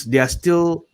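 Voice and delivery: ordinary delivery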